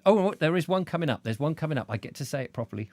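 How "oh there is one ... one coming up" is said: plain reading voice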